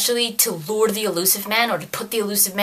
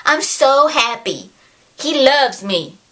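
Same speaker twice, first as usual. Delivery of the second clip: higher, gushing mock-happy voice; hammed-up adoring gush; happy Kai Lang; 5 semitones higher than usual. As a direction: mockingly play a delighted child awaiting a parent. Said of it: "He's all happy that the Elusive Man is coming".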